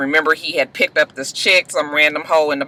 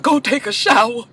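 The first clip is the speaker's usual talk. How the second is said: high put-on imitation of mama; mock the mother's strange delivery; imitating how the mother says it; mimicking mama's odd voice; 8 semitones higher than usual. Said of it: "why is she talking like that?".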